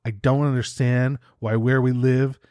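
ordinary talk

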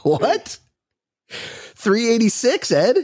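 slow, high, disbelieving repeat; what, really, episode three eighty-six?; incredulous. Real, not acted. Repeating caller's question in disbelief.

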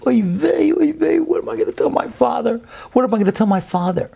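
put-on high, wailing child's cry, fast at the end; crying boy. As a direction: wail it like a frightened little boy. Boy crying over spilled oil.

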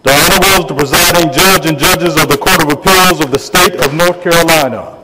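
booming formal court crier call; loud ceremonial proclamation; town crier. Real, not acted. Court marshal opens the session.

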